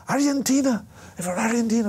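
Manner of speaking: put-on high, bright kid's voice; a teenager proudly naming his country, pitched high; proud young fan. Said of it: "He said".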